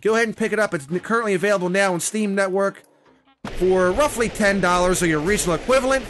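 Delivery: usual speaking voice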